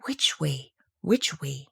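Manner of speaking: anxious murmur. A narrator's reading. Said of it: "said anxiously to herself".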